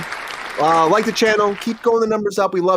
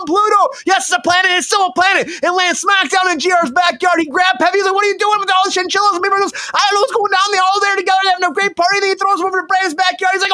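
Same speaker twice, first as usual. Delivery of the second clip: raised, rapid comic rant; wild rant; 5 semitones higher than usual. Real, not acted. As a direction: spin the absurd tale at full speed. Wild absurd story rant.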